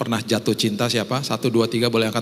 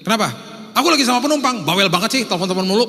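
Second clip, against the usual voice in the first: much higher, louder irritated snap; snapping at wife; 9 semitones higher, 5 dB louder, much faster than usual. Retold or imitated. Driver snapping at his wife on the phone, loud. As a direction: bark it down the phone at the nagging wife. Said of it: "dapat telepon tiba-tiba gini".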